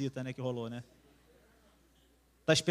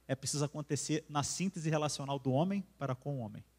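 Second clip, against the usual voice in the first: ordinary talk, nothing marked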